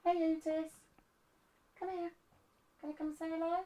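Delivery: ordinary talk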